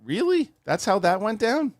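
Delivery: high squeaky mock incredulity; hammed-up disbelieving viewer; mock disbelief. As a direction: act baffled at the scene, pitch way up.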